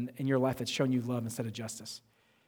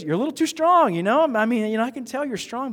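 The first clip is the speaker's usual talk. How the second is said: much higher, louder mock professor voice; imitating the professor; 8 semitones higher, 6 dB louder than usual. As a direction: squeak it out as the short professor. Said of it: "he goes, he goes".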